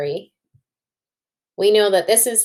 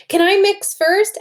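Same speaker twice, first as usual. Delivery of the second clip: put-on high, loud eager child asking; eager little girl; 12 semitones higher, 5 dB louder than usual. Teacher voicing Tess begging to mix first, high and eager. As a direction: beg to go first like a small girl.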